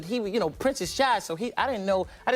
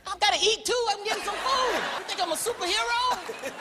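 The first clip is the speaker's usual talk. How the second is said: exasperated; 13 semitones higher than usual. Retold or imitated. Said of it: "answering fans".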